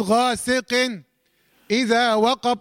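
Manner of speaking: slow غاسق, a clear break, then اذا وقب; disconnected غاسق ... اذا وقب; wrongly separated. Shown as a demonstration. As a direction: cut off after the n of غاسق, pause before اذا. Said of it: "وما افصلش اقول".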